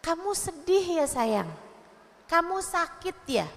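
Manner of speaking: softened, tender questioning; gently help a child name the feeling; gentle mother asking. Acted. Mother asking her crying child the feeling, gentle. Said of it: "Tapi tanyain, dikasih nama emosi-emosinya".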